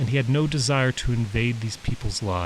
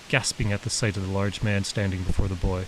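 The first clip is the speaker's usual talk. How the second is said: ordinary delivery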